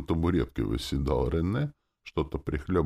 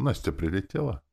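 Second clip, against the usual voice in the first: ordinary delivery, nothing marked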